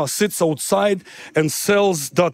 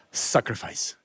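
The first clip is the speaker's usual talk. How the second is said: hushed emphasis; 8 semitones lower, 8 dB quieter than usual. Real, not acted. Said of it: "preaching emphasis".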